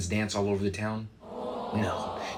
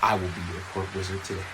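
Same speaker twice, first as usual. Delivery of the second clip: fast ad disclaimer read; 3 semitones lower, 6 dB louder, faster than usual. Acted. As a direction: rattle off the legal fine print loud and quick.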